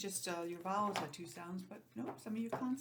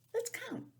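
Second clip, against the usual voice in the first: much higher bright call; cheery; 3 dB louder than usual. Real, not acted. Teacher inviting kids to count along.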